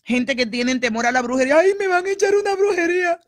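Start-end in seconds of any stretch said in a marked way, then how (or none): 1.6-3.2 much higher, louder, faster frightened whine; hammed-up panic over witchcraft; mocking fearful voice. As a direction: caricature a scared believer. Mocks people fearing witchcraft.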